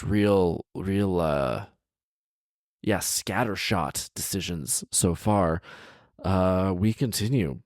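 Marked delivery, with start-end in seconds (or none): none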